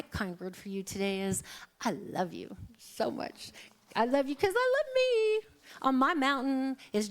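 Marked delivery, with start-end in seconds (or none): 1.8-5.5 tender then soaring. Acted. Speaker to listeners, giving a kind word, tender then high.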